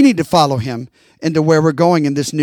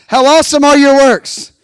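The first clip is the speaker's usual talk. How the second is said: higher, louder shared declaration; loud call-and-response; 7 semitones higher, 5 dB louder than usual. Shown as a demonstration. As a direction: proclaim it with the congregation. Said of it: "Can y'all say that with me?".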